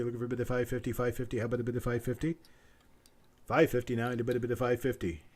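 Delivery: rapid auctioneer patter, looping the price; auctioneer bid calling. Acted. Auctioneer calling for bids from stream viewers, quick rolling patter. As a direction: rattle off the bid call like a sale barn auctioneer.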